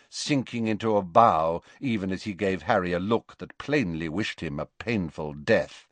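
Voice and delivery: ordinary delivery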